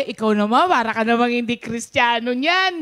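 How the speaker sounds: playful mock scolding of mother; teasing. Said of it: "Binibiro namin siya".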